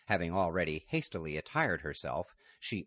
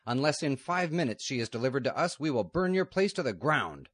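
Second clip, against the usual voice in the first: higher, louder threat of fire; threatening outlaw; 8 semitones higher, 5 dB louder than usual. Acted. Outlaw threatening to burn the convent, loud and harsh. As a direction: give the ultimatum harshly.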